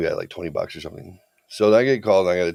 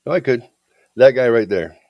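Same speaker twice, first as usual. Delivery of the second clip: louder, raised, insistent retort; obstinate; 4 semitones higher, 8 dB louder than usual. Retold or imitated. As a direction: point him out stubbornly, punching it loud. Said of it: "getting more obstinate".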